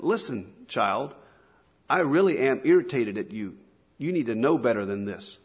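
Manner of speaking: usual speaking voice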